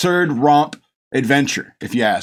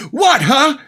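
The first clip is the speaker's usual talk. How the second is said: higher, louder confused yelp; mock baffled; 12 semitones higher, 5 dB louder than usual. Retelling Jack Burton's reaction, higher louder mock confusion. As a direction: imitate a dumbfounded hero blurting.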